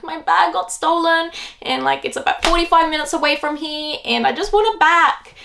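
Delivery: high-pitched mock whimper; retold crying, voice pitched high; imitated tearful. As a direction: plead like a tearful child, playacted.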